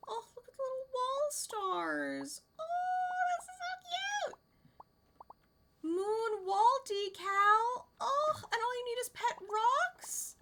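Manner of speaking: high squealy delight over cute things; gushing over cute. Real, not acted. Streamer browsing cute decorations for chat, high excited cooing. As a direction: coo at each cute item, delighted.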